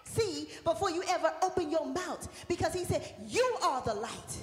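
loud, high preaching, half sung over music; full-force preaching. Acted. Pastor urging the congregation to shine, loud preaching. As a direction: proclaim it, ringing out to the church.

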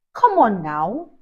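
ordinary delivery

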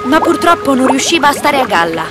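ordinary delivery

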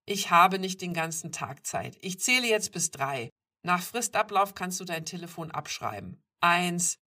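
loud, level-pitched threat; forceful, demanding male character; stern ultimatum. A narrator's reading. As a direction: count down at full voice, impatient. Stranger gives ultimatum, counts.

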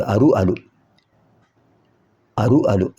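teacher's demo of "అరు, అలు", r first, then l; letter-sound demo. Shown as a demonstration. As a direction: say "అరు, అలు" as a pair, contrasting r with l. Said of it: "అరు పక్కన అలు కూడా ఉంది".

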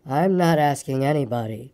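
low, slow donkey grumble; gloomy Eeyore. A narrator's reading. Eeyore complaining from the end, low gloomy voice. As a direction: drone it out low and glum.

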